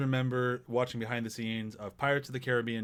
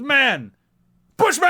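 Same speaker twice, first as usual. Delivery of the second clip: triumphant high yell of "Man! Bushman!"; proud shout; 11 semitones higher, 9 dB louder, slower than usual. Retold or imitated. Retelling the bushman reveal, shouting triumphantly. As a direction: finish the reveal with full pride.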